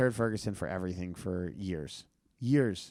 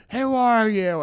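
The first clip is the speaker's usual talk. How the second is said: much higher and louder mock yell; high angry demand; 12 semitones higher, 9 dB louder, slower than usual. Danny demands the caller's name, high and loud. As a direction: bark the question like an outraged stranger. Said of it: "And he went".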